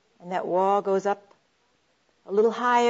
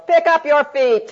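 loud, higher-pitched impatient holler; yell; 5 semitones higher, 8 dB louder than usual. Acted. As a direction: yell it like a fed-up husband. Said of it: "he just yells".

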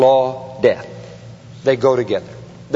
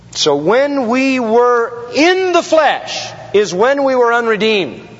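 high, forceful preacher delivery with drawn-out key words; impassioned, slow-building sermon emphasis; emphatic preaching; 6 semitones higher than usual. Real, not acted. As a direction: drive each phrase home from the pulpit.

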